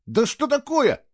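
high, put-out exclamation; disgruntled. A narrator's reading. Boy getting up off the floor, disgruntled. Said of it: "недовольно пробурчал Фло".

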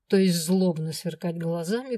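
ordinary delivery

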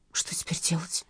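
tearful, breathy whisper; sobbing whisper. A narrator's reading. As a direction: whisper it through a sob. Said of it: "всхлипнула Люба".

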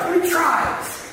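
"tries" drawn out in a high voice; stress on TRIES. Shown as a demonstration. Pastor pleading for laughs, "tries" stretched out high. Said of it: "have to sense the word tries".

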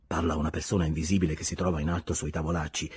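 plain reading voice

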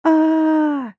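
long high scream; falling scream. Acted. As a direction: scream while jumping. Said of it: "прокричала я".

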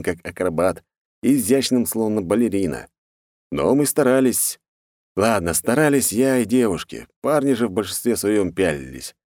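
ordinary delivery, nothing marked

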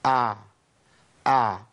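أع as two separate short throaty takes; model still ayn. Shown as a demonstration. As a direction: say each أع with a brief measured ayn, no longer. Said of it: "نطقها بالشكل ده". (أع ساكنة بتوسط)

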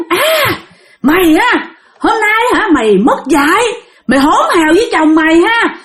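high-pitched acted threat from an enraged husband; mock bellow, pitched high without much extra volume; angry hollering. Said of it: "Là ổng la làng lên vậy".

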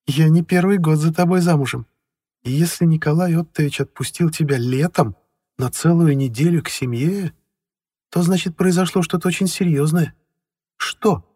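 probing wife. A narrator's reading. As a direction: press him for the truth. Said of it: "пристально посмотрела".

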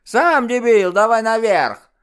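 очень высоко и громко, по-детски грубо; рявкни как злобный ребёнок; детский окрик. Acted. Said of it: "ответил второй близнец". (грубый детский крик)

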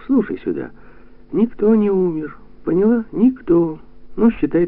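high, animated insistence; upbeat, emphatic reassurance pitched high; brisk pep talk. A narrator's reading. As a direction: drive the point home brightly. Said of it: "Тимоша говорил бодро".

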